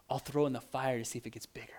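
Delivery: unvoiced, sneaky whisper; whispered plan. Brother scheming behind dad's back, whispering. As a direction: whisper it like a kid plotting.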